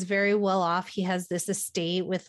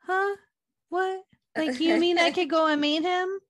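much higher, incredulous "Huh? What?"; mock surprise; 9 semitones higher than usual. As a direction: act out a kid's wide-eyed shock at the news. Acting out Hallie's surprise.

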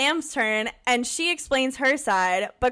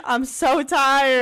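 normal talking voice

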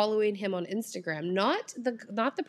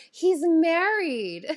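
drawn out slowly, higher and louder, He's married; shocked reveal; 8 semitones higher, 6 dB louder, much slower than usual. Retold or imitated. Telling his friend about the wedding band, slow shocked outburst. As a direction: stretch it out in disbelief. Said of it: "like that".

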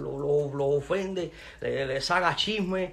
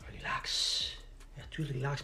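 whispered relax; 9 dB quieter, slower than usual. Real, not acted. Calming himself down, whispering relax. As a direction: let the word relax drop into a whisper. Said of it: "fíjate que no estoy ni alterado".

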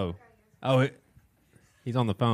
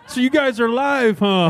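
imitated caller in a high put-on voice; high-pitched mock caller; Steve; 9 semitones higher than usual. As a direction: lift it high, mimicking the local legend. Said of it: "Steve is a legend in Charleston".

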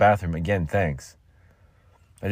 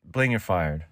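ordinary talk, nothing marked

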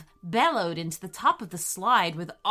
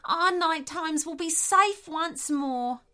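high-pitched, relieved animal voice; grateful echidna; 7 semitones higher than usual. Acted.

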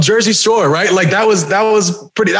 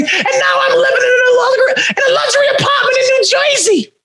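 big wrestling promo, pitched way up; big jerk from Jersey; 12 semitones higher, faster than usual. Acted. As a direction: crow it like a hammed-up Jersey villain. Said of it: "this big jerk from Jersey".

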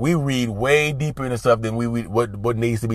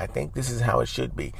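softer, unsure, trailing off; hesitant; 9 dB quieter than usual. Shown as a demonstration. Showing the weak tone not to use, quiet and unsure. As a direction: hedge it quietly, no conviction. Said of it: "as opposed to".

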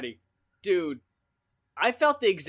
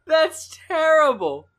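laughing groan; 9 semitones higher, 7 dB louder, slower than usual. Real, not acted. Co-host groaning at pun.

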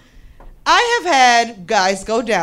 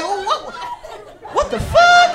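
shrill rising shock, laughing; slower than usual. Retold or imitated. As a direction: jump high in disbelief. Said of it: "I was just like".

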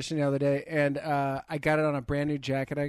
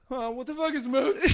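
baffled deli guy; 9 semitones higher than usual. Retold or imitated. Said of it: "the guy behind the counter was like".

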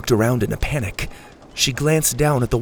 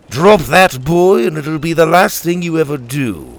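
louder, firm warning from above; threatening hero; 4 semitones higher, 4 dB louder than usual. A narrator's reading. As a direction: make them freeze with a threat.

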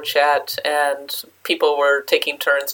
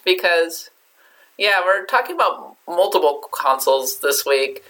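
normal talking voice

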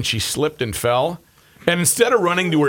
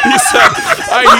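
laughing; 8 dB louder, much slower than usual. Real, not acted.

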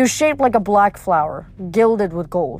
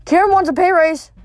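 blurted out much higher and louder; blurting it out, pitched up and loud; 9 semitones higher, 4 dB louder than usual. A narrator's reading. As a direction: let it burst out before thinking. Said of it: "I blurted".

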